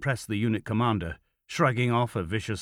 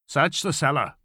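raised commanding tone; firm order; 6 semitones higher than usual. A narrator's reading. Officer to his men, ordering a search. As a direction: give it as a curt military order. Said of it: "Kroll ordered".

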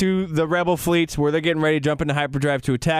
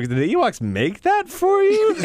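much higher mocking cartoon voice; Family Guy bit. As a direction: squeak it like a cartoon character teasing. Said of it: "that's a Family Guy joke".

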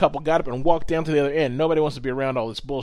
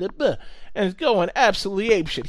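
normal talking voice

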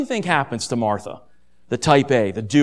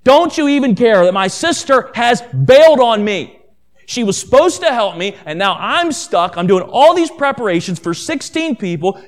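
loud, higher-pitched angry outburst at Jesus; angry reproach; 3 semitones higher, 5 dB louder than usual. Acted. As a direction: burst out in exasperated accusation, stressed and fed up. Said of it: "You can just hear the anger in her voice".

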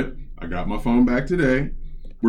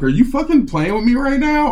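high-pitched, incredulous challenge; exasperated, high-pitched disbelief; retold outrage; 12 semitones higher, 4 dB louder than usual. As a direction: retell it indignantly, pitch shooting up. Retold angry exchange with phone agent.

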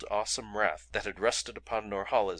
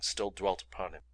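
usual speaking voice

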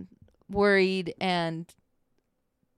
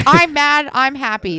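loud, higher, blunt "I'm mad. I'm happy."; mock dim husband; 6 semitones higher, 9 dB louder than usual. Teasing her husband about naming emotions, in a loud, higher, blunt mock voice. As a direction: bark out the only two feelings he knows.

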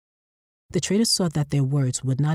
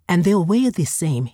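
louder, eager salesman add-on; fleshworker's pitch; 4 semitones higher, 6 dB louder than usual. Acted. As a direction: tack on the selling point. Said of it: "he added".

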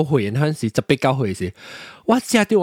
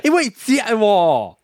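laughing, higher and drawn out; high, slow laughing exclamation; 5 semitones higher, much slower than usual. Real, not acted. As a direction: burst out amused, stretching the words. Both laugh about how much eaten.

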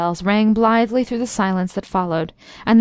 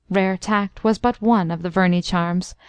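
ordinary delivery

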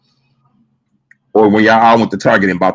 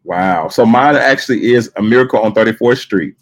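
ordinary talk

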